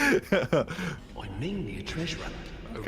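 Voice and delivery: slow hammed-up Irish accent. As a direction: drawl it like a leprechaun. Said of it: "trying pretty hard with that accent".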